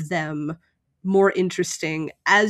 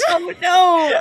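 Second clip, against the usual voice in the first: high laughing mock dismay; 11 semitones higher, 6 dB louder than usual. Real, not acted. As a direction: giggle it out in fake horror.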